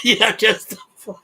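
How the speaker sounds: laughing. Real, not acted. Laughing fit on a livestream.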